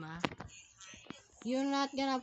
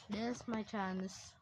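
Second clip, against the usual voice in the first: ordinary delivery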